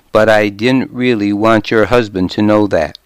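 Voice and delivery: ordinary delivery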